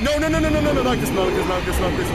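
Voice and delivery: loud frantic repeated no; panicked pleading. Real, not acted. Caught by monster.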